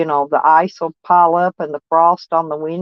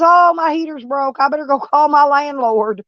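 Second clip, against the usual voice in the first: much higher, mock-panicked truck stop guy; 8 semitones higher than usual. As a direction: ham up his sudden panic, pitched way up. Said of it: "He goes".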